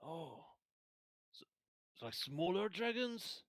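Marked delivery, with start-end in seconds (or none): 2.0-3.4 high, slow, wondering question; curious soldier voice. Acted. Young soldier asks the party, high and slow. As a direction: ask it slowly like a wide-eyed young man.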